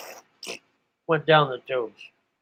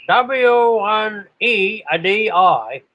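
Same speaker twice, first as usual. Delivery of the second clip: imitated on-air call; 5 semitones higher, 4 dB louder, slower than usual. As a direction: call sign drawn out, louder and higher. Call sign repeated in on-air style.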